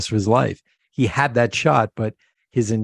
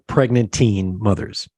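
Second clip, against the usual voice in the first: usual speaking voice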